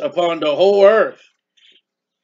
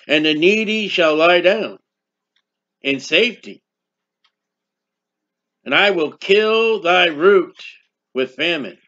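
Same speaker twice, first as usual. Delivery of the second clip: measured pulpit reading of scripture. Acted. Reads scripture aloud.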